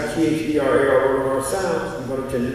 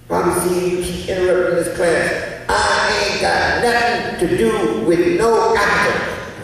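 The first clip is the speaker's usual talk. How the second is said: mimicking a classmate; 7 semitones higher than usual. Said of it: "one sister said".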